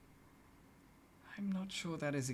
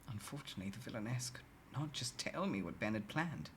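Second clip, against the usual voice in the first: usual speaking voice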